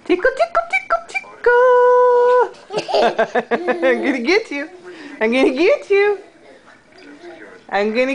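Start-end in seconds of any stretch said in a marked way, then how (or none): 0.0-2.5 giggly tickle attack. Real, not acted. Mother tickling baby.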